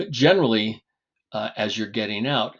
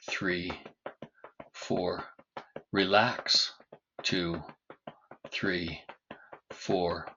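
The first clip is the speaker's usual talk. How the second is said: guided breathing count; much slower than usual. Acted. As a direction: lead the breathing gently.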